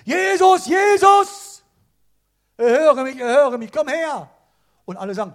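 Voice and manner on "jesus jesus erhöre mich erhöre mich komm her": high, loud yelling for Jesus; desperate shout. Acted. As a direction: cry out from the roadside with everything you have. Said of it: "Und er schreit".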